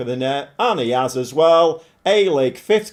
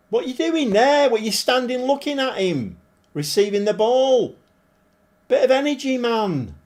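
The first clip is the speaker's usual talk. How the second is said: frustrated shouting; 7 semitones higher than usual. Real, not acted. Manager shouting at defenders watching the attacker, frustrated. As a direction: bark at the lazy defenders.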